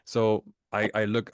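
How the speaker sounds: ordinary delivery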